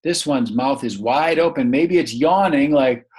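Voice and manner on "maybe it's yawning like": usual speaking voice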